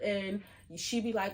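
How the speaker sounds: ordinary delivery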